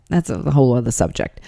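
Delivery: ordinary talk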